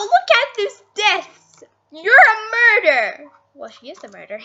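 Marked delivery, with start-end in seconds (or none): 0.0-3.3 high, loud accusation; accusing shout. Real, not acted. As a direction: yell it at the killer. Accusing the killer.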